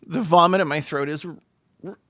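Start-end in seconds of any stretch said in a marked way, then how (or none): none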